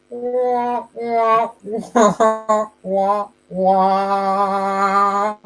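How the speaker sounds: muffled trombone-like wah wah; muffled wah wah talk. Shown as a demonstration. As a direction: wah wah like a muted horn. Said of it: "I can say it better".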